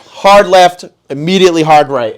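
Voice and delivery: loud, high coach-like commands; barking route instructions. Retold or imitated. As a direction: call out the play, loud and emphatic. Re-enacts coaching his friend on routes.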